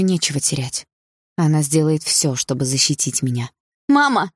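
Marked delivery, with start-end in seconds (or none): 3.9-4.3 sudden high cry. A narrator's reading. Daughter calls to her mother, crying out. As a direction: call out in alarm, pitch leaping. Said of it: "выкрикнула я".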